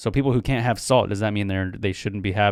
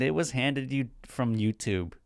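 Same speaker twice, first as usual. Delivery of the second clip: ordinary delivery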